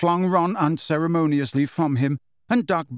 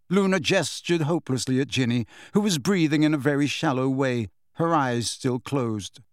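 usual speaking voice

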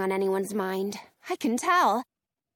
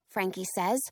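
plain reading voice

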